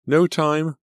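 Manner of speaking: high, loud hurried call; calling back. A narrator's reading. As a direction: call it back over your shoulder. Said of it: "Selena called back".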